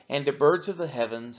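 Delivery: ordinary talk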